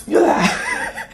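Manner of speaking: very high, loud strained "yaah"; squealed throwing cry, hammed up; mock battle yell. Re-enacting throwing a smoothie back at Kate, high mock yell.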